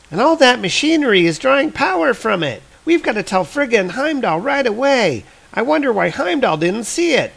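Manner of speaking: put-on high young voice, eager and urgent; excited kid. Volstagg's children at the sword, high urgent kid voice. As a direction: talk like a kid who found something big. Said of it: "the kids are like".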